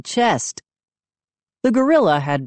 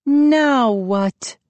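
demanding; 9 semitones higher than usual. A narrator's reading. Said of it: "she demanded".

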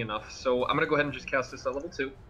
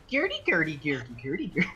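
pixie; 3 semitones higher than usual. Acted. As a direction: chirp it like a tiny pixie. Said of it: "a very female pixie voice going".

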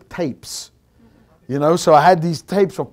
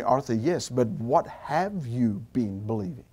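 lower, softer, gentle questioning; a calm voice from above, lower and hushed; voice of the Lord; 7 semitones lower, 8 dB quieter than usual. Retold or imitated. The Lord quietly questioning Arthur, low and soft.

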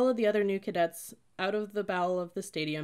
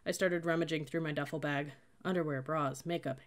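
usual speaking voice

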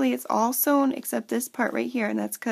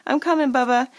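calling out; 3 semitones higher, 7 dB louder than usual. Real, not acted. Mom calls out to her child off camera, louder. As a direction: raise the voice to reach Bubba in the next room.

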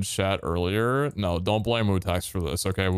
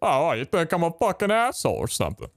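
mock-offended, high New Yorker; mock New York accent; 7 semitones higher than usual. Gamer play-arguing with a friend, mock New York accent. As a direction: act affronted in a joke New York accent. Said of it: "as a New Yorker, I feel offended".

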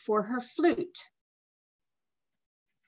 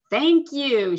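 loud, high thank-you shout; 5 semitones higher, 6 dB louder than usual. A narrator's reading. Girl shouts thanks to crane, loud and high. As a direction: call it across the river. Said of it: "she shouted".

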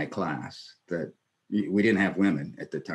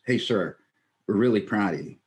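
normal talking voice

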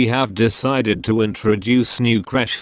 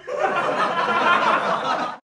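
high-pitched laugh. Acted. High laugh breaking calm.

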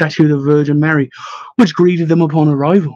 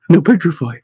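usual speaking voice

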